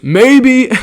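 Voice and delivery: friend's loud cocky comeback; cocky dare. Retold or imitated. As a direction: toss it back like a dare. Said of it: "Evan turns around and goes".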